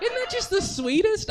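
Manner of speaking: high, loud gushing squeal. Acted. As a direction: coo it like something adorable. Gushing about biker vests.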